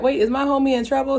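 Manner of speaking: quick, high-pitched worried double take; mock alarm. Reviewer voicing Toothless worried for his friend, mock alarm. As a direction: play at sudden concern, fast and high.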